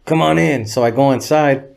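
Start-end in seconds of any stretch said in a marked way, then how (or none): 0.1-0.6 lightly voiced homie greeting, a bit higher and louder; a light take on the rapper welcoming his buddy in. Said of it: "I'll do the voice".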